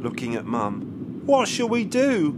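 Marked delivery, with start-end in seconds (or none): 1.3-2.3 rising alarm in the voice; higher, anxious plea; worried question. A narrator's reading. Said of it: "asked Grandpa".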